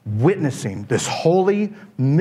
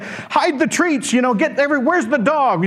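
frantic host; 9 semitones higher than usual. Retold or imitated. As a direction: scramble to hide the mess. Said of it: "he's like".

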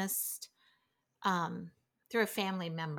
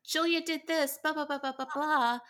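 high, whining tattle; whiny kid tattling; 7 semitones higher, 3 dB louder than usual. Retold or imitated. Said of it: "and says".

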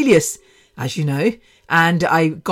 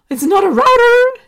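high, loud, gasping squeal over "router" said as ROW-ter; mock American outrage; 7 dB louder than usual. Podcaster mocking Americans' "router" in a shrill squeal. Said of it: "it does my head in when Americans say".

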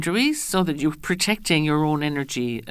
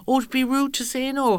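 higher-pitched voice of a worried people-pleaser; acted-out polite alarm, pitched up; mock concerned objection; 8 semitones higher than usual. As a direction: fret aloud over seeming impolite.

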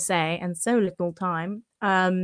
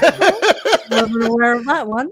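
mock surprise; 2 semitones higher, 5 dB louder than usual. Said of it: "I was like".

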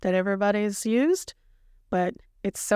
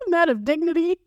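high, louder line through laughter; laughing confession; 7 semitones higher, 3 dB louder, faster than usual. Real, not acted. Wrapping up the vlog, laughing at herself. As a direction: laugh it out at yourself.

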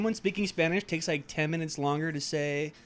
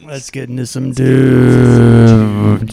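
slow, loud radio announcer; 4 semitones lower, 16 dB louder, much slower than usual. Pretend. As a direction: stretch it out like a radio host. Drawn-out announcer voice.

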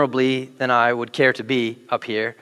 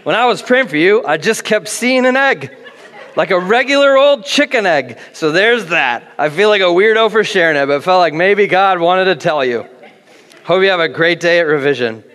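higher, louder, laughing through the sister's message; laughing read-out text; 4 semitones higher, 5 dB louder than usual. Retold or imitated. Pastor reads his sister's egg text to the church, laughing. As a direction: read the text while cracking up. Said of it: "it said this".

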